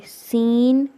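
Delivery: سین with a whistling hiss; سین said with a sharp whistle-like s; whistled seen. Shown as a demonstration. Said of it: "سیٹی کی آواز نکالیں گے".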